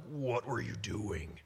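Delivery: slow, stern mock scolding; a hint of an angry thundering god. Preacher imitating an angry God scolding, slow mock stern. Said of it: "throwing lightning bolts and being like".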